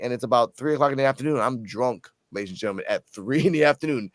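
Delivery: normal talking voice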